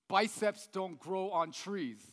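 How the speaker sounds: slow, weighty slogan read; slow poster slogan. Shown as a demonstration. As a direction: read it like a gym poster motto. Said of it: "you'll see a poster like this".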